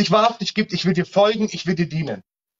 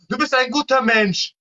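higher, mock-sweet praise, hammed-up; ironic flattery; 5 semitones higher than usual. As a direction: play the crowd-pleaser who tells people they're good. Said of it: "das will doch der Mensch hören". (ironisch schmeichelnd, höher, gespielt süßlich)

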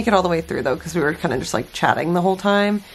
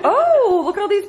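excited cry; 12 semitones higher, 4 dB louder than usual. Real, not acted. Cries out on seeing potatoes.

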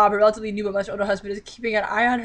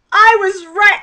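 higher, louder gleeful "I" rising; triumphant squeal; 9 semitones higher, 8 dB louder, slower than usual. Real, not acted. Reader to viewers, gleeful about being right. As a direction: crow it out with glee.

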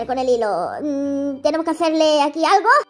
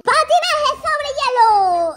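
level announcer; 7 semitones higher, much slower than usual. Acted. Announcing ice skating level.

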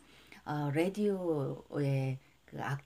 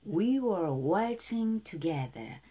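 plain slow spoken lyric; spoken demo before the sung take; slow model reading; much slower than usual. Shown as a demonstration. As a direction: read each word slowly and clearly. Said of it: "첫 소절 다시 한번".

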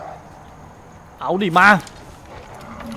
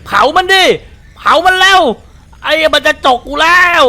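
loud, high-pitched panicked shouts at the screen; panicked yelling; 12 semitones higher, 7 dB louder than usual. Real, not acted. Gamer yelling at his teammate to burn the animals, panicked shout. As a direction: yell in real alarm as the animals charge.

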